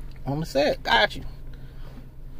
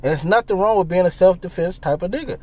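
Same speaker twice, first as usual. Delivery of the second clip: ordinary talk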